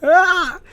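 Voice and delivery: a short, loud, high cry of frustration; frustrated yell. Real, not acted. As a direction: let out a sudden yelp of overload. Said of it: "my brain feels like I'm exploding".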